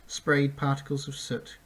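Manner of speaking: ordinary delivery, nothing marked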